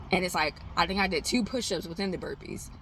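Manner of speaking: ordinary talk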